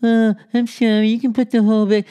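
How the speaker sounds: high, sweet mock apology; high-pitched fake-sweet apology; mock girlfriend. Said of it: "when she goes".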